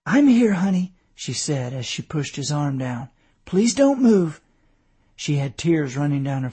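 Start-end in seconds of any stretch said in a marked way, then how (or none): 0.0-0.9 higher, louder reassurance; anxious wife reassuring. A narrator's reading. Tearful wife reassures husband. 3.5-4.4 high, louder plea; anxious wife pleading. A narrator's reading. Wife pleading with injured husband.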